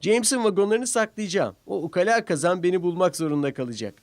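gleeful little train with a secret plan; scheming little engine. A narrator's reading. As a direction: plot the hide-and-seek with glee. Thomas voiced with a plan.

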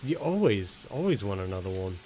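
usual speaking voice

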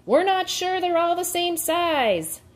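high, sustained theatrical call; shouted. A narrator's reading. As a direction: proclaim it to the whole crowd. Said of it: "he shouted".

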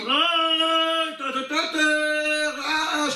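high, long-drawn mock wail; imitated crowd shouting. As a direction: imitate a whole crowd crying out in prayer. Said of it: "וכולם צועקים".

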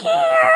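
put-on very high shrill girlish yell; little girl scream. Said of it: "it was like a little girl screaming".

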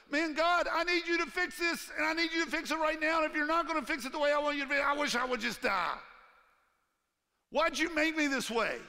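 loud, high, pleading cry that rushes in the middle; desperate prayer. Retold or imitated. A struggling believer crying out to God, loud and desperate. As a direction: plead with God, frantic and raw.

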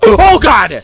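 real fright at a jump scare; terrified gasp. Real, not acted. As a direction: gasp then scream it, high and loud.

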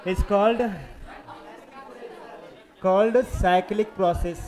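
called cyclic process, stretched out slowly; slow dictation. Shown as a demonstration. Teacher dictating the key term to students, very slow. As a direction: drag out the term for note-taking.

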